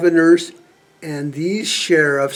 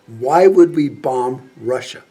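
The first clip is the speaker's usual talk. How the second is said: deliberate challenge; 2 semitones lower, 4 dB louder, slower than usual. Acted. General questioning a senator's war call, slow deliberate challenge. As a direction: space out the words as a firm challenge.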